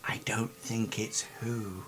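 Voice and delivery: secretive NPC whisper; hushed, mysterious whisper. Acted. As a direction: breathe it like a secret.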